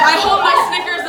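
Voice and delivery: ordinary talk, nothing marked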